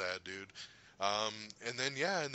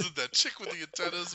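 quoting Rocket; 8 semitones higher, 3 dB louder than usual. Retold or imitated. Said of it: "he goes".

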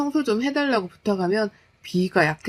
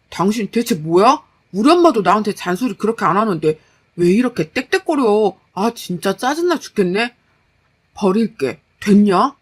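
louder, irritated retort; annoyed husband; 6 dB louder than usual. Acted. Husband fed up with his wife's nagging, annoyed. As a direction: complain hotly, then give in grudgingly.

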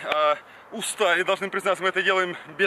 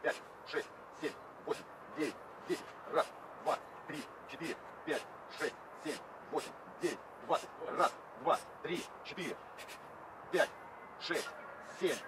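whispered counting; 18 dB quieter, faster than usual. Real, not acted. Counting reps under breath.

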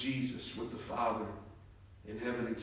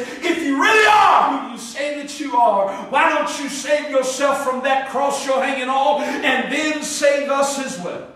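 jeering thief; 5 semitones higher, 6 dB louder than usual. Acted. Mocking thief on the cross.

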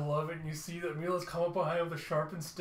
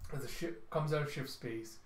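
ordinary talk, nothing marked

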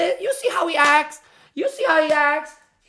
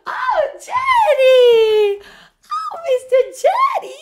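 squealing dog greeting; 13 semitones higher, 4 dB louder than usual. Real, not acted. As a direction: gush over the dog in a sky-high voice. Greeting his dog with delight.

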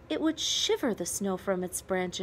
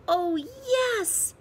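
much higher, joyful exclamation; boy cheering the idea of decorating the tree; delighted cry; 12 semitones higher than usual. A narrator's reading. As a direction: squeal it with a child's delight. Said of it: "Peter cried".

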